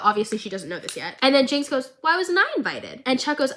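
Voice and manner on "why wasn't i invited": pouty question. Retold or imitated. Jinx pouting question.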